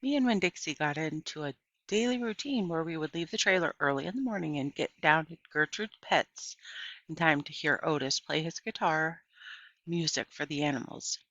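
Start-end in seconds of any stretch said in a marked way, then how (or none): none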